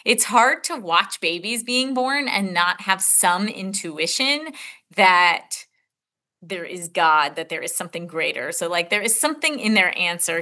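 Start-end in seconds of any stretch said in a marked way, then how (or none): none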